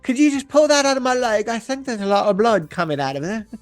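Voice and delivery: mock panicked rock star. Host acting Aldous begging someone to pull coral out, hammed-up high whine.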